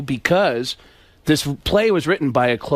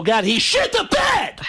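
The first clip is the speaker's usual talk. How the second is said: much higher, slow shocked yell; mock outraged shout; 13 semitones higher, slower than usual. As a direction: shout it in disgust. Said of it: "turn on your radio and go".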